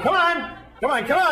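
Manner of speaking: high insistent coaxing; yoga teacher urging. Retold or imitated. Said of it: "And he's going".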